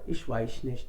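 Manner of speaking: low, soft brush-off; uninterested German reply. Retold or imitated. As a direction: mumble it to decline the cinema. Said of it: "वो अगर ये बोल दे ना".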